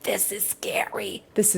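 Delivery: hushed, breathy-free whisper of dread. Real, not acted. Vlogger whispering to viewers about the stalker: scared whisper. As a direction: whisper it like it's spooky.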